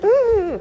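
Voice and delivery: a high, loud appreciative mmh; high loud hum of delight. Real, not acted.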